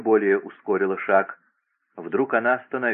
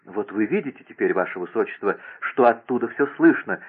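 plain reading voice

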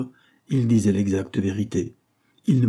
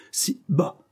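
angry interruption; 9 semitones higher than usual. A narrator's reading. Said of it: "interrompit mademoiselle avec colère".

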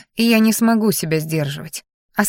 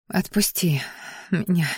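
halting breathy whisper; broken whisper; 7 dB quieter than usual. A narrator's reading. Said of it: "прерывисто зашептала".

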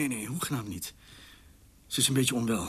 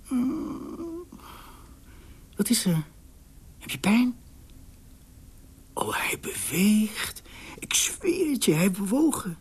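much higher, half-whispered amazement; thrilled, breathless; 10 semitones higher, slower than usual. Acted. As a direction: gasp at the baby moving. Excited about the moving baby.

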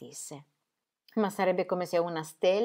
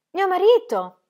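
much higher and louder, a blurted exclamation; 14 semitones higher, 14 dB louder than usual. A narrator's reading. Wife blurting out that Robert is her husband, loud exclamation. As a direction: announce it as if it were obvious.

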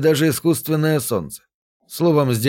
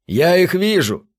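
higher, ringing exclamation of discovery; strained excitement, pitched up; tense ringing voice; 6 semitones higher than usual. A narrator's reading. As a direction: call it out with a taut, ringing voice. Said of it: "Голос его напряжённо звенел".

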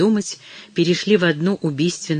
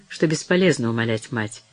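ordinary delivery, nothing marked

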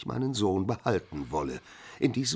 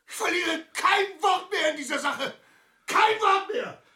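furious shout; 5 dB louder than usual. Acted. Karenin cuts off the argument.

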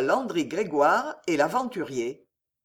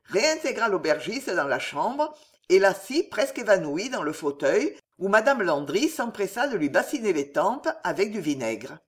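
plain reading voice

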